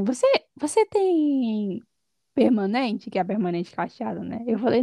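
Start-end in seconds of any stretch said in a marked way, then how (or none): 0.0-3.1 put-on Korean accent in Portuguese. Imitating an old Korean lady's heavy accent asking about her hair. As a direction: drag out the question like an elderly Korean lady. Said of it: "ela falava com um sotaque bem forte".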